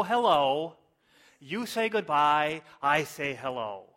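building into loud, declaimed pop lyrics; mock-moved lyric recital. Preacher reciting Beatles lyrics to congregation, mock-earnest and emphatic. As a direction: declaim the simple words as great poetry. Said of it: "I hope you're as moved by these".

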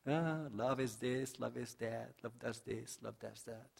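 lightly mocking the churchgoer's dreamy sigh. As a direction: mimic a listener drifting off on sweet words. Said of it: "I like to listen about it".

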